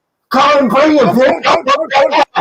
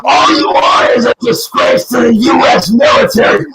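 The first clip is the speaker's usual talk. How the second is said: furious yelling. Real, not acted. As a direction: voice cracking as he yells at his father. Screaming insults at his father.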